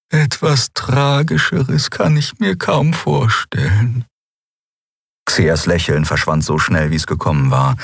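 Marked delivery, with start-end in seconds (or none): 0.1-4.0 put-on high, slow voice of a gentle old healer; old healer Xea. Xea, the old healer, speaking high and gentle.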